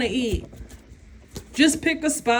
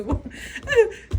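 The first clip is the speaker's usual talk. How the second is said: squealing high laugh; high giggle; 10 semitones higher than usual. Real, not acted. Laughing at the vision before repeating the line, high giggle. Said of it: "I get these visions".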